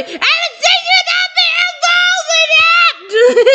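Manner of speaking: very high, drawn-out yell. A narrator's reading. Zachary yelling at Anastasia, yelling. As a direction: call out sharply across the house. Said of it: "Zachary yelling".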